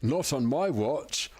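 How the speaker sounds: drawn-out movie line, stretched slowly; slow dramatic quote. Pretend. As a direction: deliver it slowly like a film hero. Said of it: "and then he said".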